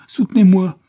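hurlé bien plus haut et bien plus fort; cri de terreur. Acted. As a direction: crier comme en plein cauchemar. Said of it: "comme s'il était en proie à un cauchemar horrible". (l'enfant terrifié hurle pour qu'on le soutienne)

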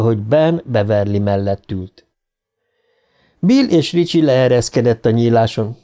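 usual speaking voice

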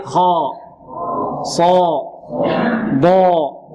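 full, emphatic خاء، صاء، ضاء with held dark aa; thickened, sustained letter sounds in صاء and ضاء; heavy letters. Shown as a demonstration. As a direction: model خاء، صاء، ضاء one by one for repeating. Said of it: "انطقوا معي".

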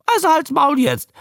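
high squawked creature voice; high-pitched newt croak; parroting newt. A narrator's reading. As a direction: mimic the order in a high newt voice. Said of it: "brummte der Molch".

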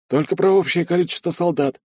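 put-on a scared young sergeant answering; frightened soldier. A narrator's reading. (испуганный солдат, высоким голосом)

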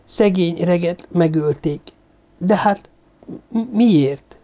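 put-on high, halting voice of a grieving girl; distressed. A narrator's reading. Girl mourning murdered Reg, faltering and distressed. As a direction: falter and stumble on the question.

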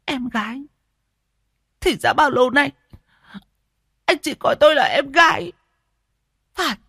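very high, wounded lament; Siêu, choked with bitterness. A narrator's reading. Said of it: "Cô Siêu uất nghẹn". (uất nghẹn, tủi hờn)

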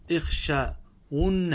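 "اخشون" stretched very slowly, syllable by syllable; drawn-out demonstration. Shown as a demonstration. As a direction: break "اخشون" into long, separate syllables. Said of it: "انظروا ماذا حصل". (نطق «اخشون» ببطء شديد مقطعًا مقطعًا)

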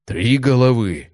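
slow, uneasy outburst; uneasy barbarian. Acted. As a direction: blurt it out with barely hidden worry. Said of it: "пробормотал варвар с плохо скрытым беспокойством".

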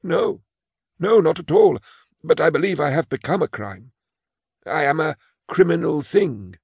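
put-on high, timid old clerk voice; a frail old clerk confessing his fear; old man Imus. Imus insists he is a crime.

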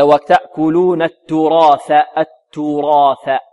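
slow التراث, clear t first, th last; correct ta and tha. Shown as a demonstration. Teacher shows students the correct slow recitation, t then th in التراث. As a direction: draw out التراث as turath with heavy ra. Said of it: "هكذا".

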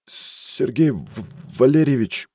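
nervous stutter on the name; stammering in fear. Acted. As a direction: stammer it out scared. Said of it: "заикнулся мужчина".

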